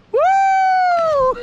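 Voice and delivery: loud high whoop mid-jump; excited whoop. Real, not acted. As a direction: let out a big whoop. Jumping into river.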